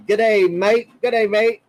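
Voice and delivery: hammed-up Aussie g'day, mate; Australian accent. Shown as a demonstration. Vlogger trying out an Aussie greeting on chat, hammed-up Australian accent. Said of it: "How do you say that to a woman?".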